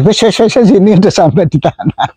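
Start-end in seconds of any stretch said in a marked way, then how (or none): 0.0-0.7 rapid, higher run of "wes" bursts; repeated whooshes. Shown as a demonstration. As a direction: imitate the bird still flapping noisily up there. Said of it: "ini yang sini masih".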